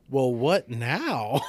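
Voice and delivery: very slow, loud stunned question; drawn-out comic bewilderment; dumbfounded. Retold or imitated. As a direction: stretch out each word in disbelief. Retelling being stranded behind door.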